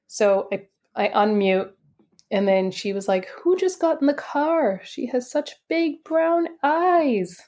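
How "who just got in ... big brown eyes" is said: high, drawn-out marveling; high-pitched wonder, slowing at the end; wonderstruck. Retold or imitated. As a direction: imitate an awed stranger's exclamation. Healer's first reaction, retold.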